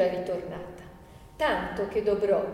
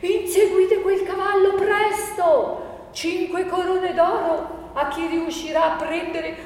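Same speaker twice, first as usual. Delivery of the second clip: high, loud, frantic command; furious master yelling high and loud; shouting at full breath; 8 semitones higher, 6 dB louder, slower than usual. Acted. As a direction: bellow orders to give chase. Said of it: "gridò con quanto fiato aveva".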